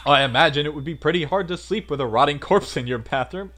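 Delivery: loud, tactless wisecracker; joking guy's character voice. Acted. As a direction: crack a morbid joke loudly. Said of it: "hard to get his voice".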